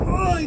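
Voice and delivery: sudden high wail of grief; high anguished cry. Retold or imitated. Pastor voicing God's lament, high pained wail. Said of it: "Dios dice gimiendo".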